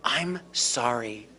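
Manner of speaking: low, slow, soft I'm sorry; sincere apology. Shown as a demonstration. Caregiver apologising to an angry patient, low and slow. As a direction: back off and apologise gently. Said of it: "two really magic words".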